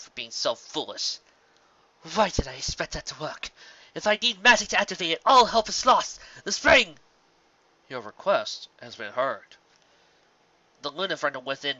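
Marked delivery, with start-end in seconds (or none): 2.1-7.0 high and loud, faster as it builds; rising alarm. Acted. Luna scolds herself, realizes. 7.9-9.6 calm mirror voice. Acted. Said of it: "using her own voice to say the words".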